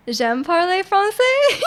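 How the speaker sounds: high, slow French recital; drawn-out beginner French with a laugh; beginner's French. Shown as a demonstration. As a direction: offer limited French, slowly and giggly. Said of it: "that's, that's about it".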